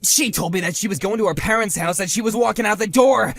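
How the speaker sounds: loud, high, accusing yell; blaming shout. Acted. Husband blaming the rival for his failing marriage, angry shout. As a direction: hurl the blame at him.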